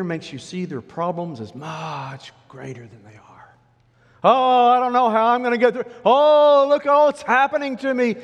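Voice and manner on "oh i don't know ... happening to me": high, loud mock wailing; exaggerated panic. Fearful person seeing problems as much greater, exaggerated panic. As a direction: overblow every word like a disaster. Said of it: "It makes you exaggerate".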